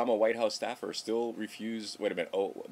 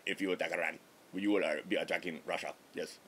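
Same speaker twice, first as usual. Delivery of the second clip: lower, badly faked Russian accent; piss-poor Russian accent; 3 semitones lower, 3 dB quieter, faster than usual. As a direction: do a hammy, half-hearted Russian impression. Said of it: "That's my piss poor Russian impression".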